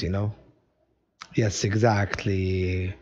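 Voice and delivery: usual speaking voice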